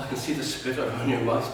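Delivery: ordinary delivery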